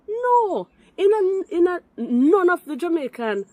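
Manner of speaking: heated high-pitched rebuttal; agitated protest. Real, not acted. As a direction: pile on the protest. Heated call-in argument.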